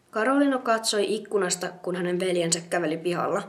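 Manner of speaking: ordinary delivery, nothing marked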